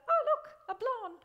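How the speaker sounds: mock dumb guy. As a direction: ham up a guy ogling a blonde. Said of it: "the guys go".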